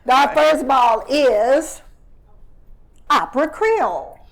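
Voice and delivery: loud, slow number calling; bingo caller. Pretend. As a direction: call it out like the bingo hall. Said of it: "the bingo caller".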